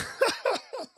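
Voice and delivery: high, breathy burst of laughter; incredulous laugh. Real, not acted. Laughing at the absurd orphan clip, high incredulous laugh. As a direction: laugh in disbelief.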